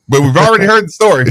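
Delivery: high-pitched put-on agent; mock brush-off. Co-host imitating insurer who knows the story, dismissive.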